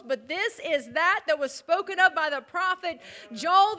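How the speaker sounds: loud, building proclamation, slowing at the end; preaching at full force. Acted. Peter preaching at Pentecost.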